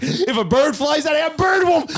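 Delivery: high, loud imitated panic; panicking. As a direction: shriek it like the frightened volunteer. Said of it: "she's, like, panicking".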